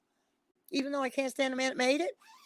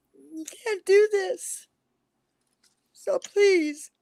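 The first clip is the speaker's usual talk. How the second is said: higher, louder, slow voice on the verge of crying; near tears; 7 semitones higher, 4 dB louder, much slower than usual. Real, not acted. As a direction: cry out "You can't do this" as the tears come. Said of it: "I'm gonna start crying in a minute".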